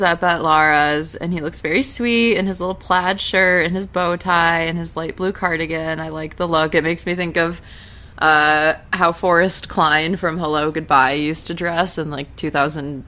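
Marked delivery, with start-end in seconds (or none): none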